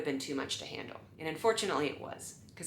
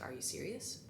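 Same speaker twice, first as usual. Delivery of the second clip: hushed; 15 dB quieter than usual. Retold or imitated. Attendant's hushed reply.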